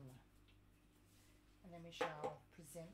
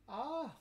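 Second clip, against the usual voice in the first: startled yelp; 6 semitones higher, 7 dB louder than usual. Real, not acted. Startled at feet seen.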